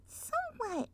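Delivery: high, cheeky retort; sassy. Acted. Pinkie answering Rarity, high and cheeky. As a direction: toss it back cheekily. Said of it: "says Pinkie".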